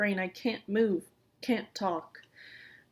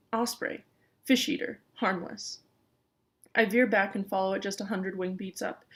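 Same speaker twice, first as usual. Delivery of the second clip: plain reading voice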